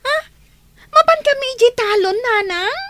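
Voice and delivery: high, loud disbelieving cry; shocked protest. Acted. Child to mother, loud shocked protest. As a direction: balk at being sent to the fields.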